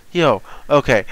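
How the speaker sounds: normal talking voice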